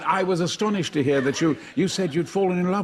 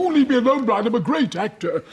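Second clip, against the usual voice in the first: pompous actor; 5 semitones higher, 3 dB louder than usual. Retold or imitated. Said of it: "goose the great actor, Sir John Gill".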